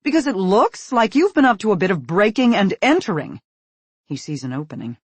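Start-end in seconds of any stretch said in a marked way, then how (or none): 0.0-3.4 loud, biting sarcasm; mock relief you don't feel; sarcastic. Angry mother mocks her son's excuse, loudly sarcastic. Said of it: "in her most sarcastic voice".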